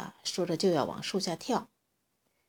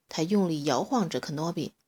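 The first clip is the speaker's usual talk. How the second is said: ordinary delivery, nothing marked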